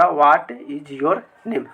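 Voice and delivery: what is your name drawn out very slowly; slow dictation. Shown as a demonstration. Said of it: "तो यहाँ पे हो जाएगा". (बहुत धीरे उच्चारण)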